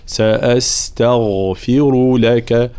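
slow سأستغفر, thin ت, heavy voiced غ; heavy ghain. Shown as a demonstration. Teacher models سأستغفر for students, slow and precise. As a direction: drag out سأستغفر, light ت, full غ. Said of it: "تفخيم الغين".

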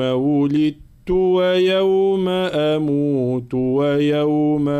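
slow melodic tajweed chanting; measured Quranic recitation. Shown as a demonstration. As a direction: chant the verse slowly for students to repeat. Teacher recites Quran verse.

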